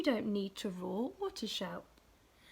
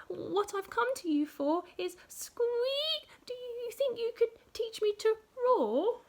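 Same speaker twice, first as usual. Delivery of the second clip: mouse voice; 8 semitones higher, 4 dB louder than usual. Acted. Mouse asks lion.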